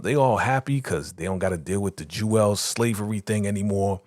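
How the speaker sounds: normal talking voice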